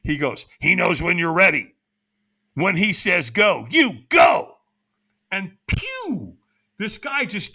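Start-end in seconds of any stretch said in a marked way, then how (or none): 0.6-4.6 loud, urgent cryptic instruction; loud mock whisper. Stranger instructing him in his ear, loud and intense. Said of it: "kinda whispers in my ear, kinda loudly". 5.7-6.4 high, drawn-out "pew"; imitated whoosh. Stranger disappearing into the night, whoosh noise.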